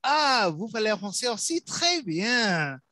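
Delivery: high, showy French delight; playfully delighted French. Pretend. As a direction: coo over it in playful, high-pitched French.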